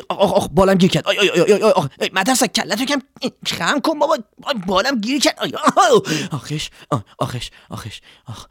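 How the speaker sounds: high bird voice, ouch after ouch; wing caught, squeaking in pain; pained little cries. Acted. Swallow with her wing stuck, high pained squeaks.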